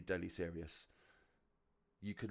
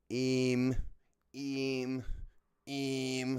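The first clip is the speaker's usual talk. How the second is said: mocking Scouse "im", higher and louder; 5 semitones higher, 5 dB louder, much slower than usual. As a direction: sneer out the Scouse sound. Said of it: "the main reason it drives me nuts is".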